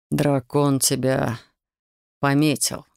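low, slow growling dragon voice. A narrator's reading. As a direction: growl it like a beast. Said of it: "прорычал он вдруг". (рычащий голос дракона)

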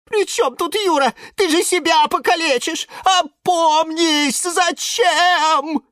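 very high, distressed voice; frantic pleading. Acted. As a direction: beg her to come to her senses. Lemon pleads with the raging plant.

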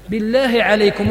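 ordinary talk

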